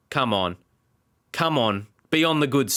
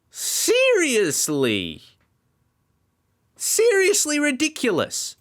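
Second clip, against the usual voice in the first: slow, then a much higher fed-up wail; exasperated disbelief; 13 semitones higher, slower than usual. Real, not acted. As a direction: stretch seriously, then squeal ridiculous in frustration. Frustrated by the result.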